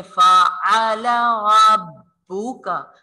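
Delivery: slow chanted verse; recitation to repeat. Shown as a demonstration. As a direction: recite slowly for the class to follow. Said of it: "मेरे साथ साथ आप लोग भी practice कीजिए".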